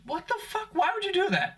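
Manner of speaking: a squeaky what the fuck, floored by it; outraged disbelief. Real, not acted. Comedian floored by a prank video, squeaky disbelief.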